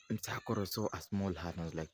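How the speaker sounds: plain reading voice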